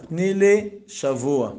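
"תני לי שבוע" drawn out slowly, word by word; slow insistence. Acted. Repeats the plea with deliberate emphasis.

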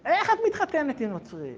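high-pitched appalled question; incredulous high appeal; acted reproach. Acted rabbi pleading with daughter.

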